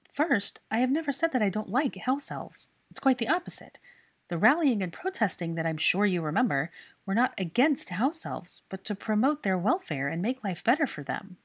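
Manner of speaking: ordinary delivery, nothing marked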